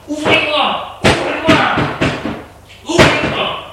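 high-pitched, strained yelling; furious cry. A narrator's reading. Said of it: "De fureur, il crie et tape". (cri de fureur, très aigu)

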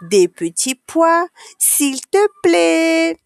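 put-on slow, high, drawn-out childlike politeness; polite pleading. A narrator's reading. Child asking the canteen lady for peas, drawn-out polite plea. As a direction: stretch out the magic word sweetly high. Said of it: "il faut dire le mot magique".

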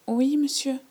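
put-on small, high child's voice answering an adult; shy little polite reply. Timid girl answering a grown-up, high and polite. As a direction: squeak out a polite yes.

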